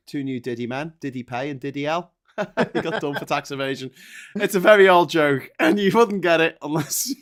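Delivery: normal talking voice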